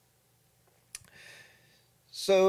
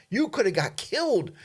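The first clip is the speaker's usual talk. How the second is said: nervous wife; 8 semitones higher than usual. Retold or imitated. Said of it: "she was so nervous".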